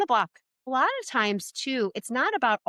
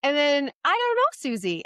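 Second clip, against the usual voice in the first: curious interest; 5 semitones higher than usual. Shown as a demonstration. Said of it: "And you say".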